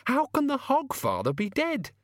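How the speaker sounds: high-pitched woman demanding answers; incredulous, voice pitched right up; Susan, disbelieving. A narrator's reading. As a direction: question it in a high, shocked voice. Susan questions Death, shocked.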